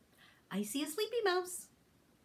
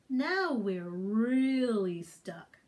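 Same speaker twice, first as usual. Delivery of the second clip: weary groan; 6 semitones lower, 4 dB louder, much slower than usual. Acted. Mr Gumpy voiced stuck.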